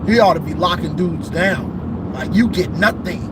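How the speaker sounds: ordinary talk, nothing marked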